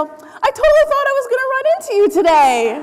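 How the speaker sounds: high, gleeful acted hail; hammed-up cheerful driver calling out; cheery greeting.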